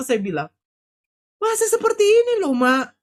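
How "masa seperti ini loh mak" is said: retold high-pitched protest, easing down at the end; pleading reproach. Daughter chiding mother for giving up, pleading reproach. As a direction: protest in disbelief, voice leaping up.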